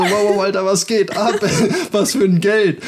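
startled disbelief. Retold or imitated. Confronted by the thugs, startled laughing disbelief. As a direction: act baffled and caught off guard.

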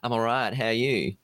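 lightly mocked Aussie vowels; terrible Australian accent. Host answering guest in a mock Australian accent. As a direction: put on a bad Australian accent. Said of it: "speak in terrible Australian accents".

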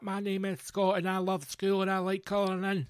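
mock school pupil. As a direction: reel off a childish personal statement. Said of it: "and you're like".

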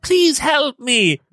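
high, drawn-out mock plea; imitated begging. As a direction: stretch it out as a retold plea. Retold victim begging the guard.

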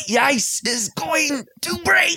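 high, strained mock cry, drawn out; slow, high-pitched imitation of a climactic outburst; Christopher Walken. Imitating the actor's build by the end, high mock cry. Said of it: "And then by the end, he's like".

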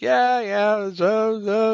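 high-pitched, drawn-out mimicking voice; exaggerated high-voiced impersonation of a politician; President Trump. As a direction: imitate his speaking style, pitched well up. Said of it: "he talks like that".

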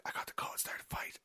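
unvoiced, hushed aside; acted furtive whisper. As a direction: whisper it like a secret signal to an accomplice. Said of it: "he's like".

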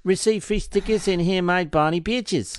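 hammy South African ad read; South African accent. Acted. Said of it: "worst fucking South African accent I've ever heard".

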